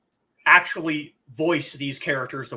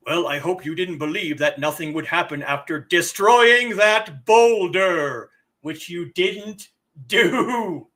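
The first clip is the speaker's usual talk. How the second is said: hammed-up elder, swelling louder and drawn out; epic elder voice; 4 dB louder than usual. Elder scolding player for destroying the boulder, hammed-up epic voice. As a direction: build to a slow, booming epic finish. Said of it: "we are speaking to an elder".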